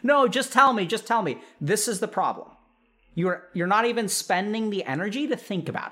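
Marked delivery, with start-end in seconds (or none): 0.0-1.4 demanding parent. Acted. Acted narcissistic parent demanding.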